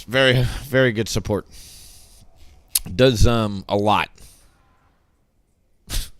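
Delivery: usual speaking voice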